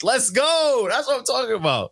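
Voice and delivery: high hyped cheer; excited. Real, not acted. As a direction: cheer it like a fan. Hyped by a top five pick.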